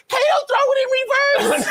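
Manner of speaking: very high mock shout. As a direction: yell a frantic order to the driver in imitation. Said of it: "[name] pops out of the sunroof".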